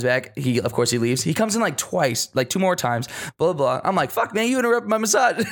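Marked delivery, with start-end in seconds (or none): 4.1-5.4 higher-pitched, peeved complaint at normal volume; mock annoyed protest. Telling friend about his interrupted massage, mock annoyed. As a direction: play up being irritated, half amused. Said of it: "This kinda pissed me off".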